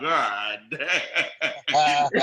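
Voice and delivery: low, drawn groan breaking into laughter; laughing groan. Retold or imitated. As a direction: take the hit and crack up. Retold reaction, laughing at the jab.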